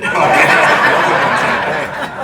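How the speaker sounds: a big burst of real laughter; loud laugh. Real, not acted. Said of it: "This is not good".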